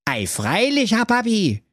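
put-on high, proud young son voice. Proud son answering his father, high and boastful. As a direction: beam with pride at papa.

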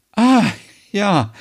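bright surprised ah; sudden realisation. Acted. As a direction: it dawns on her all at once. Merit suddenly understands.

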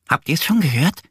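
high, eager squirrel voice; bursting to share the news; excited squirrel. A narrator's reading. Squirrel excitedly asking the duck and bear. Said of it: "fragt es aufgeregt".

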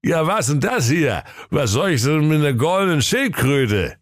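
lightly voiced puzzled old king; a hint of a tipsy king. King speaks, held voice.

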